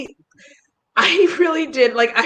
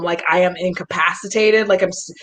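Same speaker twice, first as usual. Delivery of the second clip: ordinary delivery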